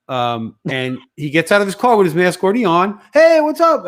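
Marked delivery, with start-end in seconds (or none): 3.1-3.8 loud, high-pitched mock hello; acted cheery greeting. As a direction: call out a big hey, imitating him. Acting out the man's greeting.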